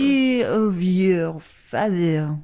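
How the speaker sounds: slow, put-on Eastern European villain; mock Eastern European spy; Eastern European accent. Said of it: "maybe Eastern European".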